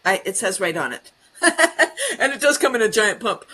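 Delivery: ordinary delivery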